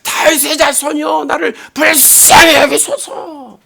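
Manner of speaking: high, straining cry for mercy, drawn out at the end; high-pitched imitated wail. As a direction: act out a blind beggar crying out harder. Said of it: "더욱 소리를 지르면서".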